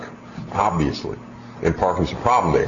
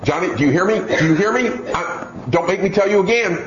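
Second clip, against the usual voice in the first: mocking a weak parent, much higher and pleading; high, fretful parent repeating herself; nagging parent; 9 semitones higher than usual. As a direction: whine the empty threats like a pushover mom. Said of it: "those parents that say".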